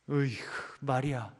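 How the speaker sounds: soft, fed-up 으이구 before the name; exasperated sigh. Shown as a demonstration. Preacher shows a second wrong way, a soft tutting sigh. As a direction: tut at her, quietly annoyed. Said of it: "이렇겠습니까?".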